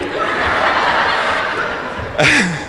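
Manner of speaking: a long breathy laugh running out of air. Real, not acted. Coach laughing at the girls' backstory for the doll. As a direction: give in to the laugh before carrying on.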